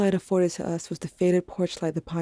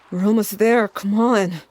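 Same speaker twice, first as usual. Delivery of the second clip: straining effort; 3 semitones higher, 7 dB louder than usual. A narrator's reading. Dragging heavy body, straining.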